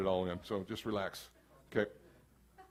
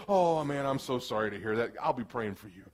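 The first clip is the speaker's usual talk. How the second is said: lower, soft, pitying condolence; a touch of churchy sympathy; sympathetic condolence; 8 semitones lower, 6 dB quieter than usual. Acted. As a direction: comfort a friend in trouble. Sympathy when friend's life falls apart.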